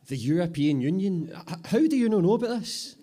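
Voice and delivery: very high, loud exasperated disbelief; how can you not know; incredulous. Acted. Apprentice answers Bobby in loud, high exasperated disbelief.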